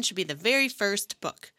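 usual speaking voice

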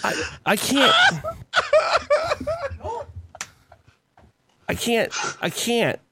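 breaking up with laughter; can't get words out for laughing; laughing helplessly. Real, not acted. Laughing too hard to talk.

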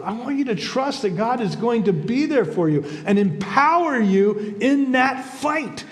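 impassioned preaching. Real, not acted. Preacher promising God's help in that fight, impassioned. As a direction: drive the promise home to the listeners.

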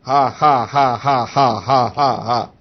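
low, even string of fake ha's; imitated forced laugh, evenly spaced; put-on dry laugh. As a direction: laugh deliberately without feeling it. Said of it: "But the whole laughter was".

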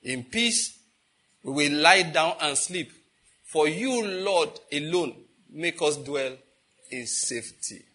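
prayerful declaration. Shown as a demonstration. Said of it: "prophesy upon your household".

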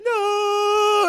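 high shouted no; mock anguished no. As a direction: cry out no in despair. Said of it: "She's like".

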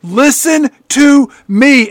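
high, hammed-up desperate shouting; mock scream, slowing to punch each word. Griffin warns his father about the house, screaming. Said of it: "Griffin is just screaming at his father".

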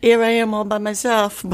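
high-pitched poor-little-me voice; mock self-pity. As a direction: play up being left all alone, half-joking. Mock self-pity, no visitors on Sunday.

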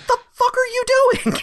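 high mock squeal of disbelief; squeaky disbelief. Reacting to the dumb scene with a high squeaky voice. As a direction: squeak it, baffled.

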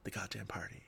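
hurt and stung, voice dropping low; wounded, hurt. Retold or imitated. Wife stung by husband's brush-off, hurt and quiet. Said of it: "she just goes".

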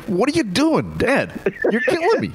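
high-pitched mock agony at Dad; exasperated son imitation. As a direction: whine at Dad's awful joke, pitch soaring. Imitates exasperated son hearing joke.

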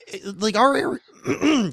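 hoarse, cracking voice giving out; losing voice. Real, not acted. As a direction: voice breaking up hoarsely. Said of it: "I'm losing my voice here".

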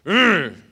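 loud hardcore "urgh"; forceful macho growl-grunt; tough-guy grunt. Acted. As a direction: grunt it like a fired-up zealot. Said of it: "see yourself as a tough, hardcore".

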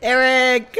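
loud, high chant of a name; crowd shouting. Acted. Player plays the crowd backing Eric, loud shouted cheer. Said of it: "You hear the crowd shouting".

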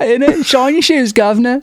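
put-on high cockney shoeshine boy accent; hammed-up cockney urchin; mock cockney accent. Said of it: "your British accent is always in it".

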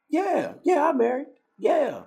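high, loud, over-eager agreement; eager yes. Retold or imitated. Acting out own eager past reply.

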